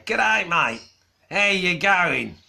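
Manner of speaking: a Brit-born speaker hamming up Australian; attempted Aussie accent. British-accented speaker trying to sound Australian to mates, failed Aussie accent. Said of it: "I try to sound like an Aussie".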